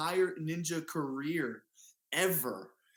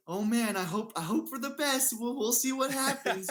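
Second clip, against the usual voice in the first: much higher, fretful mock worry; nervous hand-wringing, pitched way up; mock worried; 10 semitones higher than usual. Mock girlfriend fretting before his climb, high and anxious. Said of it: "It wasn't like".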